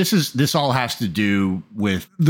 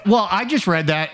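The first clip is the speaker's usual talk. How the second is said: usual speaking voice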